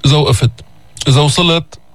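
usual speaking voice

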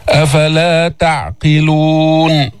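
slow, level tajweed tone; Quran recitation. Shown as a demonstration. Teacher recites verse end for learners, slow recitation. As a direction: draw out the long vowels.

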